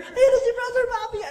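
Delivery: high, playful mock of an eager fan; excited crowd voice. Preacher mimicking the eager crowd, high and laughing. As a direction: squeal it like a delighted parishioner. Imitating crowd greeting him.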